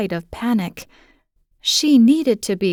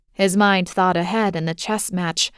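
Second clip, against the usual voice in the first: plain reading voice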